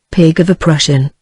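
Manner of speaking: plain reading voice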